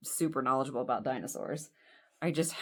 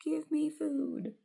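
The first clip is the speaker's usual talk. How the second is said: high, slow little dinosaur plea; squeaky-high, drawn-out creature voice; toy dinosaur voice; 11 semitones higher, slower than usual. Pretend. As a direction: pretend to be a hungry little dinosaur. Voices a toy dinosaur demanding food.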